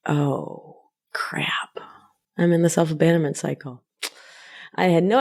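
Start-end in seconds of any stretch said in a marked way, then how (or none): 0.1-3.8 hushed, breathless whisper of dawning dismay; whispered realization. Retold or imitated. Host voicing her past self realizing the cycle, in a whisper. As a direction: whisper it like you just caught yourself.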